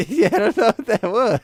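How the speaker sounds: laughing through it, high and loud. Real, not acted. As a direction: let laughter push the voice up. Laughs at the unexplained noise.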